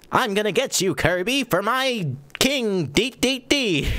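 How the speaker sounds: Escargoon's voice. Retold or imitated. Host imitates Escargoon vowing to catch Kirby for his king. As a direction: stretch it out like a fawning snail henchman. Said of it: "he talks like this all the time".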